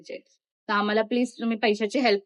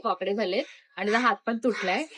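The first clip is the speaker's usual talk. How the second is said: ordinary delivery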